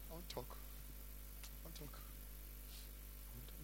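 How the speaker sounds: slow, whispered sulking refusal; sulky whisper. Retold or imitated. Offended friend refusing to talk, slow sulky whisper. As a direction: mutter it under your breath like a sulking friend.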